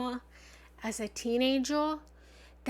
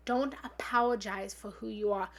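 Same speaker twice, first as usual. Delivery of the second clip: usual speaking voice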